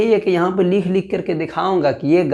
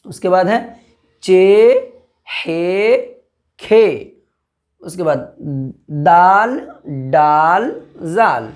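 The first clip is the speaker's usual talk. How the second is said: reciting letter names; much slower than usual. Shown as a demonstration. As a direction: call out each letter for the class to repeat. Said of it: "इसका नाम क्या है?".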